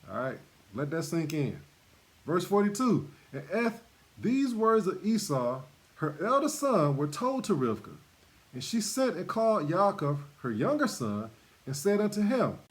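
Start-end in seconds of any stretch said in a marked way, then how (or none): 3.3-12.6 scripture reading. Acted. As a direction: read the Bible text with weight. Reads scripture aloud.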